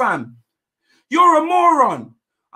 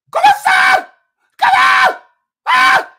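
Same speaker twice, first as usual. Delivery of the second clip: ecstatic screaming; 12 semitones higher than usual. Real, not acted. Fan screaming at his team's goal, ecstatic shrieks. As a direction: scream yourself into falsetto with joy.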